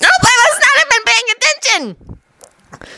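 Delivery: very high, slow squeaky voice; drawn-out, high-pitched boy's voice; Naruto. A narrator's reading. Naruto shaking his head at Neji's question, high squeaky voice.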